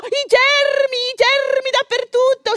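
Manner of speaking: high, outraged fussy patient; high-pitched panicked complaint about germs; indignant shrill protest. Retold or imitated. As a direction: squeal it out, scandalized about germs.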